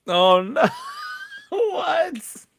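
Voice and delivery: high, loud gasp of disbelief with a laugh; real shock breaking into laughter. Real, not acted. Co-host hearing the cruel demo verdict, shocked and laughing. Shocked reaction to harsh feedback.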